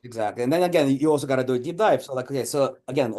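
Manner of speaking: ordinary delivery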